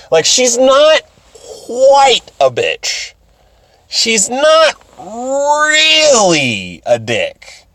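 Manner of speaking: QUITE and REALLY stretched out high and long; high-pitched, very slow reluctant qualifier; drawn-out hedging. Real, not acted. Hedging comically about a character.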